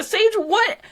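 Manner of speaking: very high, excited reveal; high-voiced wonder, drawing out the end; thrilled reveal. Game master's big reveal to player.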